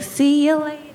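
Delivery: very high mom on the phone; put-on high mom phone voice; mom's voice. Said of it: "my mom's like".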